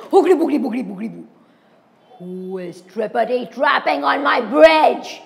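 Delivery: loud, fast troll gibberish then a challenge; troll bellowing from under the bridge. Acted.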